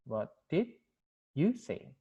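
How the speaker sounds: slow "what did", pause, then lift "you"; stress on YOU. Shown as a demonstration. Asking the listener's own answer after others refused, stress on YOU. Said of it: "di sini di you-nya".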